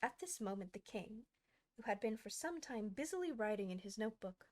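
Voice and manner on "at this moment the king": ordinary delivery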